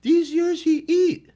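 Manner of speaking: high imitation building to a loud, exasperated yell; Doctor B getting mad. Coach insisting to his athlete over his record book, mock-angry yelling. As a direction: mimic an irate coach, pitch climbing into shouting. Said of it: "get mad at me".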